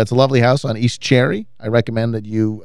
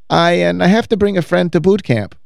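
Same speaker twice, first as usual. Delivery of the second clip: higher, sweetly put-on voice; cleaning lady impression; 6 semitones higher than usual. Cleaning lady inviting her client, high angelic impression. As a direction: quote her in a nice, higher voice. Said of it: "She says".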